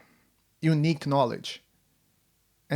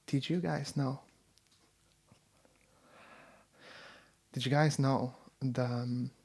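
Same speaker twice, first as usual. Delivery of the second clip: hushed know-it-all fact, softer; impression of friend; 7 dB quieter than usual. Imitating friend sharing a strange fact, softer. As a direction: lean in and drop the bombshell quietly. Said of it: "my impression of you".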